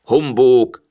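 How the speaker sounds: put-on grouchy old miser's scoff; dismissive scoff. A narrator's reading. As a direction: throw it out in contempt. Scrooge repeats his scoff.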